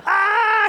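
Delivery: high-pitched imitation of wife's startled scream; mock scream. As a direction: shriek high, as if a spider just appeared. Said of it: "She screams and points".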